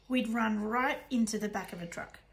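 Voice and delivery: ordinary delivery, nothing marked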